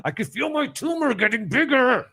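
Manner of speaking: lightly voiced paranoid complainer; mock paranoid whine. Imitating Ballmer's Wi-Fi paranoia.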